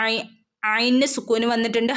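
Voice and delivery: usual speaking voice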